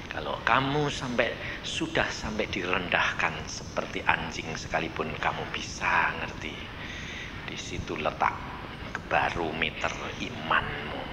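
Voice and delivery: low, soft and intimate; hushed voice of God. Acted. God speaking to the humbled believer, low hushed voice. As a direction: speak God's words close and quiet.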